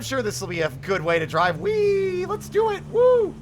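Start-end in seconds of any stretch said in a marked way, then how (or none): 1.6-3.3 high, loud gleeful cheering; thrilled squeals of joy; excited whoops. Real, not acted. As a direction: whoop like a rollercoaster ride. Excited cheers while driving.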